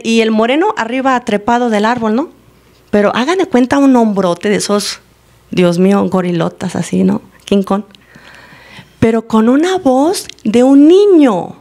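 usual speaking voice

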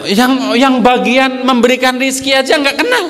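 high-pitched mock exasperation; excited teasing. Real, not acted. Preacher teasing congregation, high excited mock scolding. Mocking those who lack rizki.

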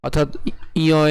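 ordinary talk, nothing marked

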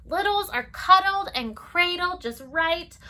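lilting storybook read-aloud; circle-time story voice; reading to children. Acted. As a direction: read it gently to toddlers. Reads picture book to children.

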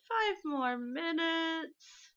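slow drowsy groan; sleepy groggy dad. Acted. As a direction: drag it out half asleep.

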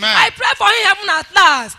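loud, forceful church prayer; fervent prayer. Real, not acted. Testifier blessing the daddy G O before church, fervently. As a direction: pray it out with full force. Prays aloud for the G O.

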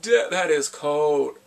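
loud, drawn-out disbelief; slow it down, impressed by the harshness; amazed reaction. Real, not acted. Vlogger reacts to harsh line.

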